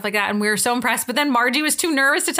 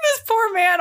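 laughing pity; 10 semitones higher, slower than usual. Real, not acted. Laughing in pity at her story.

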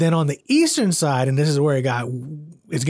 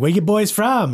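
imitated Boston accent, pitched up a bit; Boston Mike; 5 semitones higher than usual. Boston guy in a bar questioning visitors, imitated Boston accent. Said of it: "we called him Boston Mike 'cause".

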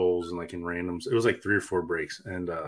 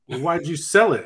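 high, louder mock wife; wife's retort; 8 semitones higher, 7 dB louder than usual. To husband who sold the card, mock wife's high demand. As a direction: imitate the wife's sharp question. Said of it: "And she's like".

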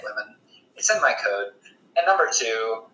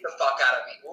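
ordinary talk, nothing marked

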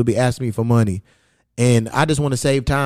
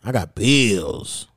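slow, lower, stretching out "bills"; drawn-out refusal; 4 semitones lower, much slower than usual. Acted. Refusing someone who asks for money, drawn-out low excuse. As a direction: drag the excuse out lazily.

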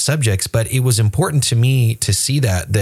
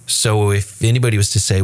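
normal talking voice

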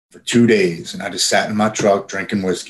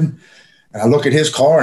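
usual speaking voice

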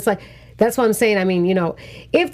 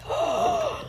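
high, quick gasp at a discovery; sharp acted intake of breath; mock-startled gasp; 7 dB quieter than usual. Viewer reacting to seeing the cameras, mock-startled gasp. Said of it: "I was like".

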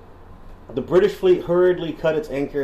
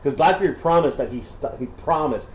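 ordinary talk, nothing marked

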